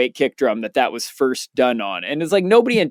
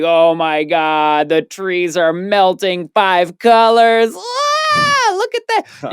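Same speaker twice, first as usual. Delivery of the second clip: awestruck tripping; 5 semitones higher, 3 dB louder, slower than usual. Retold or imitated. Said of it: "I'm like".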